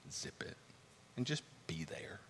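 soft whisper "zip it and just be there.". Real, not acted. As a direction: hush as if demonstrating silence. Advice to be silent.

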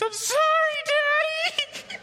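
put-on very high, squeaky little-girl apology; sweet tearful toddler sorry; tiny child voice. Toddler apologizing to her dad, very high squeaky voice. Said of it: "she turns and goes".

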